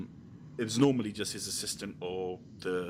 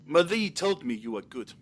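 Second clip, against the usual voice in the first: put-on accidental South African accent, raised; 7 semitones higher, 5 dB louder than usual. Manager praising the entertainer in a South African accent. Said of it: "why my voice is South African".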